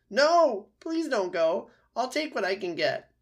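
very high, loud wounded-fox begging; desperate pleading. A narrator's reading. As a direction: beg the helper not to leave. Wounded fox begs robot to stay.

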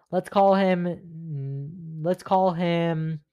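stretched-out let's call him while thinking; stalling slowly. Real, not acted. Stalling to pick a fake name, slow drawn-out thinking. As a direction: drag it out while searching for a name. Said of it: "what's a good name for this guy".

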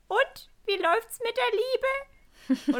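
put-on high, slow little old lady voice; drawn out, high granny voice; sweet old grandma. Grandma's first question, voiced.

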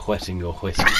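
normal talking voice

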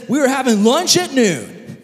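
high, loud mock-grand announcement; hammed-up jubilant call; excited proclamation. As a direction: declare it like thrilling news.

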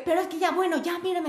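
imitating his nervous pleading; worried boyfriend begging her to look at him; flustered boyfriend.

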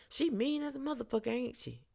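quick, high mock complaint; riled-up man. Riled man complaining to his friend about her, mock-indignant. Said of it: "now he's getting all riled up".

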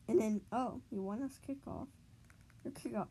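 ordinary talk, nothing marked

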